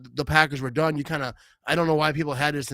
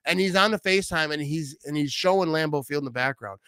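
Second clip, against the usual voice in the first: ordinary delivery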